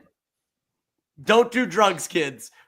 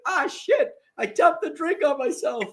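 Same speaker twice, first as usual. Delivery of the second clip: imitating Diggle; 9 semitones higher than usual. Retelling Diggle spilling his drink.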